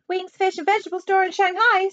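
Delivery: put-on high, little phone voice with Chinese accent; high caricatured Chinese-accented voice; small distant voice. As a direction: pitch it up as a tiny faraway shopkeeper. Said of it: "said a small distant voice".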